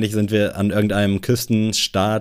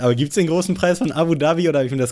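high, laughing, fast; laughing disbelief; 8 semitones higher than usual. Real, not acted. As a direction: squeal it out through laughter. Reacting to wrong guess.

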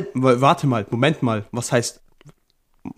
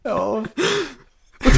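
keuchend lachen; 9 semitones higher, 4 dB quieter than usual. Real, not acted. Wheezing with laughter.

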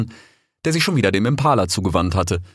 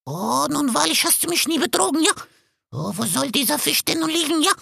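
much higher, rasping vulture voice; hoarse vulture. A narrator's reading. Vulture Bakit questioning the fox, hoarse. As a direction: croak it out like an old vulture.

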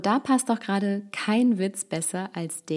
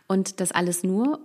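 ordinary delivery

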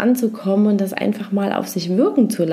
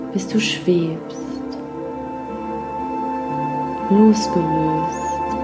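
guided meditation; 3 dB quieter than usual. Acted. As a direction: lull them gently into rest.

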